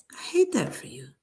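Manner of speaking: mock-sympathetic whisper, no voice at all. Commenter to reality star, sarcastic whisper. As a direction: breathe it like a sarcastic secret.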